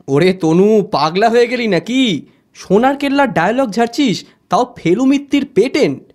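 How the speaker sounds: high-pitched mock astonishment; teasing disbelief. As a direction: rib your friend, pitch climbing. Friend teasing an excited friend. (উঁচু গলায় ঠাট্টা)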